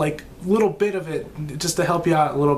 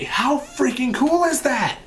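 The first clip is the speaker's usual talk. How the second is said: excited; 9 semitones higher, slower than usual. Real, not acted.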